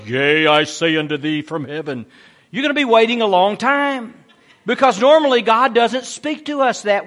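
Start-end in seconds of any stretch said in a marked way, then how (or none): 0.1-2.0 low, solemn put-on heavenly voice; mock voice of God. God speaking audibly from heaven, mock deep solemn voice. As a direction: intone it deep, like God from above. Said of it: "waiting for an audible voice".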